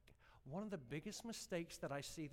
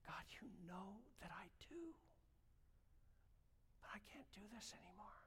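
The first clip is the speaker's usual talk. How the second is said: softer, whispered plea; a broken whisper to God; hushed weeping prayer; tearful whisper; 7 semitones higher, 10 dB quieter than usual. Retold or imitated. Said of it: "started to bawl my eyes out".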